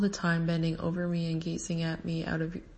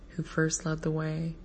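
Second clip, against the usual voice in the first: ordinary delivery, nothing marked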